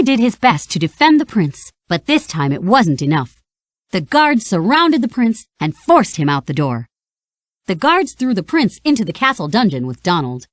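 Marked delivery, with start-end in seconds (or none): none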